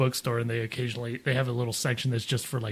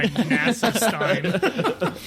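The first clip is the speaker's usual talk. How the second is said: laughing; 5 semitones higher, much slower than usual. Real, not acted. Joking about his stein.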